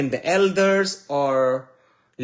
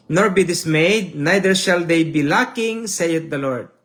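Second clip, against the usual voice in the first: solemn scripture reading; 5 dB louder than usual. Acted. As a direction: proclaim the prophecy as God's own words. Preacher reads scripture aloud.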